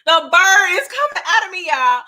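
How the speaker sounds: loud and high excited squeal; hammed-up loud squeal; mock excitement. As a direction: act like you can't contain yourself. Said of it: "I'm like".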